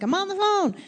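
high, pinched mock reply to the boss; exasperated high protest. To her boss calling from the other room, high exasperated reply. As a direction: fire back high and put-upon. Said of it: "I'm like".

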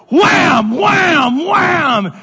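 very high, loud wham on each hit; shouted impact noises. Retold or imitated. Pastor acting out the dogs biting him, loud shouted whams. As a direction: bang out each bite like a blow.

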